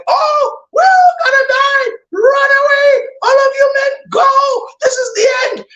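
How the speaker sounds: shrill, very high panicked shout on the edge of tears; freaking out. Retold or imitated. Terrified prefect seeing the armies of darkness, sobbing high shout. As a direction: scream the retreat like a man losing it. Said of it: "he freaks out".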